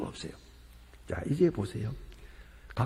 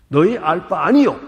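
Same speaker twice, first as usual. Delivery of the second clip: loud, firm declaration; loud commanding "너의 알 바 아니요"; loud firm answer; 2 semitones higher, 12 dB louder than usual. Acted. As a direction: boom it out as a final verdict. God's answer, repeated.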